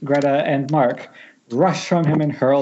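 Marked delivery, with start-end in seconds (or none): none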